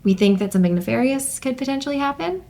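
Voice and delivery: ordinary talk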